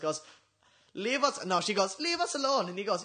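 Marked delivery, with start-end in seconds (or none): 0.9-1.4 put-on high German-accented plea. Retelling the Simpsons girlfriend's line, German accent. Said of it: "you do a German accent". 2.0-2.7 put-on high German-accented protest. Girlfriend to the busker below, high German accent. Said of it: "you do a German accent".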